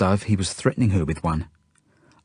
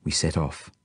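ordinary delivery, nothing marked

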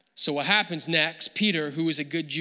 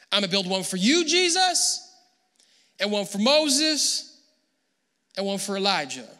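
eager offer; 3 semitones higher, 3 dB louder than usual. Acted. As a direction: offer it proudly, pitch leaping up on the names. Peter's eager offer, acted.

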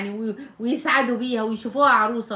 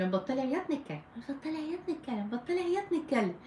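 hushed, patient repetition to a little one; calming a child; 2 semitones higher, 7 dB quieter than usual. Shown as a demonstration. Mother to her crying five-year-old, soft coaxing.

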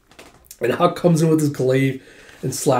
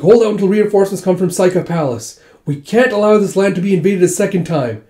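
raised, higher voice of a commander; rally them to hold firm; battle command; 6 semitones higher, 5 dB louder than usual. Retold or imitated. Commander to troops awaiting reinforcements, forceful order.

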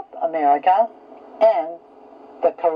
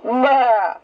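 goat bleat; 5 semitones higher than usual. Shown as a demonstration. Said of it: "Can you sound like a goat?".

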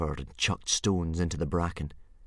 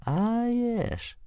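thoughtful. Acted.

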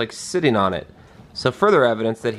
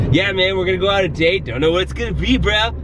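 bro voice; 6 semitones higher than usual. Retold or imitated. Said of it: "I guess I don't sound like that".

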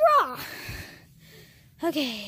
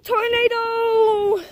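excited shout; 7 semitones higher, 7 dB louder, much slower than usual. Real, not acted. Spinning on trampoline.

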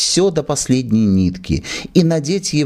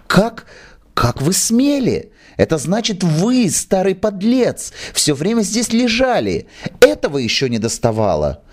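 indignant scolding; 9 semitones higher than usual. Acted. Actress scolds hiding manager.

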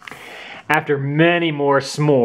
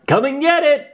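calling out. Acted. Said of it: "called Rose's father".

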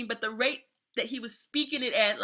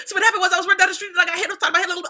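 rattled off very fast, higher and louder; mock kid rushing a made-up story; fast excuse; 6 semitones higher, 6 dB louder, much faster than usual. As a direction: gabble out a lying kid's excuse. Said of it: "I can't even talk that fast".

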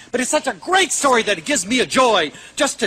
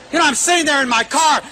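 normal talking voice

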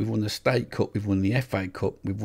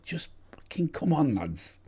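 ordinary delivery

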